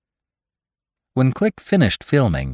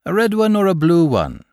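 high, breezy either-or question; light, offhand joking; casual; 9 semitones higher than usual. A narrator's reading. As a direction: toss it off playfully. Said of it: "asked Irish casually".

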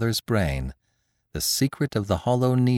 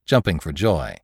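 ordinary delivery

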